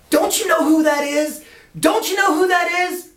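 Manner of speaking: high, loud incredulous demand; aghast boss. Retold or imitated. Boss scolding Lance in the street, high aghast disbelief. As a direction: squawk it in disbelief. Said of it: "And the boss goes".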